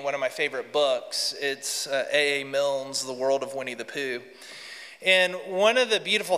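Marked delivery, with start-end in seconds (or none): none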